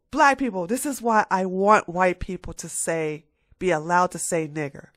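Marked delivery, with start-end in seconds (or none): none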